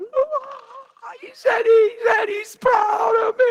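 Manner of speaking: high, loud mock sobbing wail; overjoyed mock cry, hammed-up; mock blubbering. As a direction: ham up a weepy mushball. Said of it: "I'd have been a mushball".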